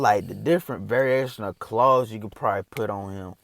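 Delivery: ordinary delivery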